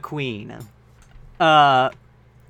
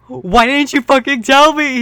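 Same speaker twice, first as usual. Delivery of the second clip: much higher, louder hammed-up shout; 9 semitones higher, 9 dB louder than usual. Draco bursting out from behind a wall, shouting. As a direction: yell it in outrage. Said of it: "he shouted".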